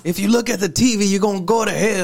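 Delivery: hammed-up fire-and-brimstone sermon; hell and brimstone preacher. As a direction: preach damnation like an old-time revivalist. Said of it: "that hell and brimstone type preaching".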